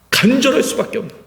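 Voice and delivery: ordinary delivery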